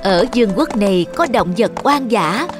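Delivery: ordinary delivery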